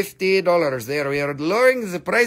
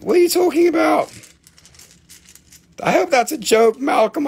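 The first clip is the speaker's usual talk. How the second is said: mock outrage; 8 semitones higher, 4 dB louder, faster than usual. Mock outrage at chipped stone.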